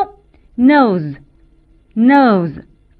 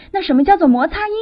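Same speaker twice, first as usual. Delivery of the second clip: usual speaking voice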